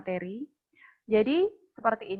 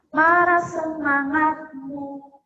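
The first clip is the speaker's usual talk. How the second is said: loud, slow and higher rally cry; rousing call; 5 semitones higher, 5 dB louder, much slower than usual. Real, not acted. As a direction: stretch it out to pump up the group. Leading ice breaking chant.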